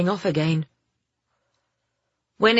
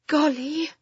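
high, sighing exclamation; sighed; 10 semitones higher than usual. A narrator's reading. Girl out of ideas in the locked van, sighed. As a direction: sigh it out, pitched up. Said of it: "she sighed".

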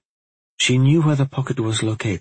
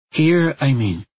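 higher-voiced girl clarifying; anxious girl; 6 semitones higher, 4 dB louder than usual. A narrator's reading. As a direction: explain anxiously, pitched up. Girl anxiously explains lost power.

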